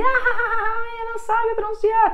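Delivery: high-pitched imitation of people jeering; mocking laughter. Classmates making fun of her accent, high mocking laugh. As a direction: crow with mock laughter, very high. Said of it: "la gente se burlaba".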